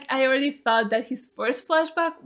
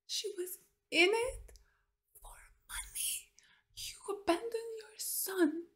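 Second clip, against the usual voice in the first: scornful whisper; 8 semitones higher, 11 dB quieter than usual. Real, not acted. Viewer condemning the mother, whispering with scorn. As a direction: drop to a bare whisper.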